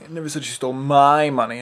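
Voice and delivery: "my" punched loud, the rest fast; stress on MY. Shown as a demonstration. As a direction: hit the word "my" hard. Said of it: "seven different ways".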